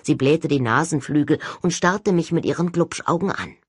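plain reading voice

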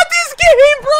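very high loud laugh into a shout; exasperated laugh. Real, not acted. Gamer laughing in disbelief at the game, exasperated shout.

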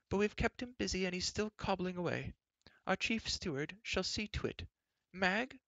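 high, bustling royal chatter; fussy queen. A narrator's reading. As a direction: rattle on, scolding in passing. Queen orders new clothes.

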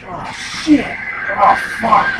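sharp high startled curse. Real, not acted. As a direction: jolt of real alarm. Sudden danger in game.

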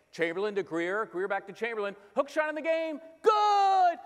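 put-on boy announcer calling his made-up game, rising to a screaming finish; play-by-play call. Kid announcing an imaginary Sixers game to the static crowd, building to a shouted call. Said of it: "I used to literally make up games".

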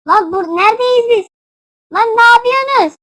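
high, loud disbelief; bewildered outcry. Real, not acted. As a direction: shout in confusion at the players. Bewildered at game events. (şaşkınlıkla bağırarak)

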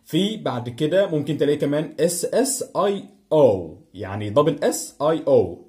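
ordinary talk

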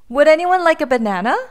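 high, fast and bright offer; cheerful fast offer; cheerful elephant. A narrator's reading. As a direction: chirp out the offer quickly.

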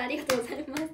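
ordinary delivery

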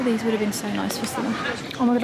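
ordinary talk

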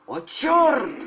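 high loud cry of alarm; startled shout. Real, not acted. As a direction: yelp in sudden shock. Sudden alarm.

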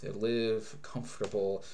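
normal talking voice

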